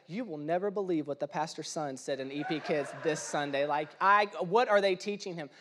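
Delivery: low, hushed mock gossip with a laugh; gossiping churchgoer. Churchgoer gossiping about the pastor's son, low and hushed. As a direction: play a scandalised church lady whispering to a friend. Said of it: "I could just see somebody".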